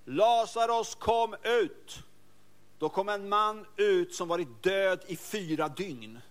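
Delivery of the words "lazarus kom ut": commanding call. Acted. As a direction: call him out with authority. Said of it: "Han ropade".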